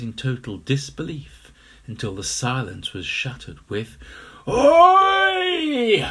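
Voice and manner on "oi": high roaring Oi; bellow. Acted. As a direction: bellow it out at full force. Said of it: "the crocodile bellowed".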